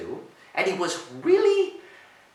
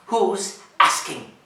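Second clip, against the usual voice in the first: tough goat; 5 semitones higher, 6 dB louder, slower than usual. Acted. Bully goat's curt reply.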